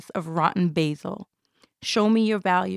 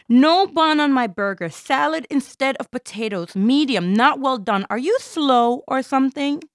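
loud, high, impatient complaining customer; rude customer complaint; 5 semitones higher, 4 dB louder than usual. Acted. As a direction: snap at the waitress over the order. Rude customer complaining about order.

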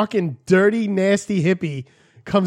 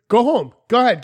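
retold cop's orders; 6 semitones higher, 4 dB louder than usual. Cop waves driver on.

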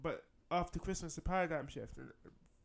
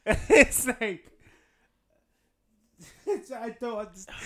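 laughing in disbelief; high, loud laughing exasperation; 7 semitones higher, 9 dB louder, slower than usual. Real, not acted.

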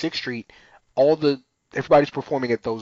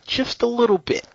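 mock innocent; 13 semitones higher than usual. Downplaying edited content.

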